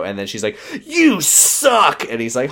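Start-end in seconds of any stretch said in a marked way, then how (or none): 0.8-2.0 put-on high-pitched childish whine of protest; mock petulant outburst. Podcaster acts fiancée lashing out when refused, mock petulant. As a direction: hurl it like a sulky, hammed-up tantrum.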